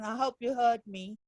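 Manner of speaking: ordinary talk, nothing marked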